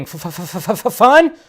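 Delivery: stammered incredulous question, rising high; exaggerated stuttering disbelief; mock stutter. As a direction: sputter on the first sound, then jump up. Mock disbelief at a review's word.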